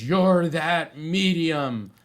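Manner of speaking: accusing recognition. Retold or imitated. Older woman recognising him, accusing.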